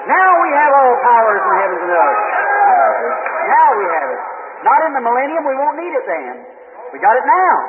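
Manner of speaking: fiery preaching. Acted. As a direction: preach it to the rafters. Preaching at full force.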